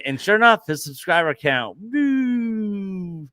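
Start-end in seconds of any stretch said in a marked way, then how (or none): 1.8-3.3 long, high, descending "woo"; imitated falling whistle-like woo. Host mimics a plunging subscriber count, sliding woo. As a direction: draw out a high sliding drop sound.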